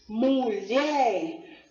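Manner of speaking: MULHER split, with LHER drawn out; stress on last syllable. Shown as a demonstration. Teacher showing an oxytone, MULHER with stressed, stretched LHER. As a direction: lean long on the end of MULHER. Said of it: "o som mais forte das palavras".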